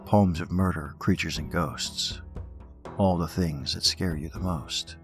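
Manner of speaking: slow, ominous host welcome; horror host. Acted. Podcast host welcomes to open mic.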